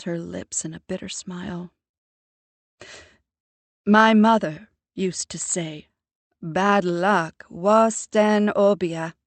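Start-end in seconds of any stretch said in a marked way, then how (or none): 3.8-5.9 lightly voiced smug mother, louder; a mother lecturing with prim self-assurance; proud mother. A narrator's reading. Mother lecturing her grown daughter about true crime, smug and louder. 6.4-9.2 put-on slow, heavy Jamaican accent on a proverb; strong Jamaican accent. A narrator's reading. Mother quoting her own mother, in a thick Jamaican accent. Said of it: "She strengthens her Jamaican accent".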